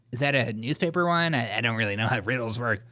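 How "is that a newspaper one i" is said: ordinary delivery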